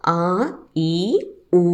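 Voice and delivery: ఆ, ఈ, ఊ each held as a long vowel. Shown as a demonstration. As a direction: stretch ఆ, ఈ, ఊ one by one, pausing between. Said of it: "దీర్ఘ స్వరంలో". (ఆ, ఈ, ఊ దీర్ఘంగా సాగదీసి పలకడం)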